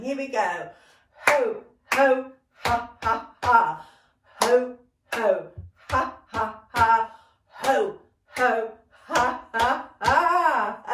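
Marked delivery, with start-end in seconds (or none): 1.3-10.8 loud, punchy rhythmic chant; really going for it. Shown as a demonstration. Teacher urging dancers on in a warm-up, full-out chant. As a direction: belt out each syllable, building towards laughter. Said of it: "Really go for it this time".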